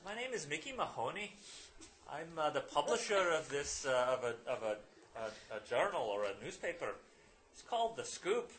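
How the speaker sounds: high, loud, hearty stage-Irish introduction; Irish accent. Acted. Publisher introduces himself and his paper, Irish accent. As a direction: introduce yourself like a proud Irish newspaperman. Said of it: "I'm gonna drop the accent".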